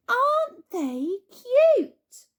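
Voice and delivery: high, loud, slow cooing; cooing grown-ups. A narrator's reading. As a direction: gush over the piglets. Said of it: "everybody said".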